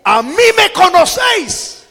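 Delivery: high, loud, ringing cry. Acted. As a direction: cry it out to the whole crowd. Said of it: "alzó la voz y dijo".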